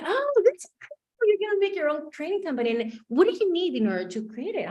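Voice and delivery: high, bright-eyed coach voice; upbeat coach. Retold or imitated. Coach cheering on her client's idea, high and eager. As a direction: play the enthusiastic life coach.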